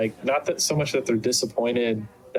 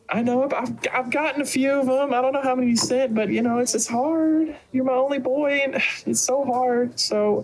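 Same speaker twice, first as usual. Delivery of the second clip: high, plaintive mama voice; high-pitched motherly lament; doting mother, wistful; 14 semitones higher than usual. Acted. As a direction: pine over your only boy. Game master plays Rain's mother.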